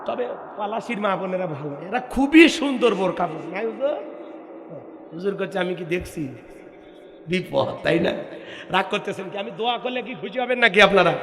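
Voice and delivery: soft teasing aside. Real, not acted. Sudden soft aside after shouting.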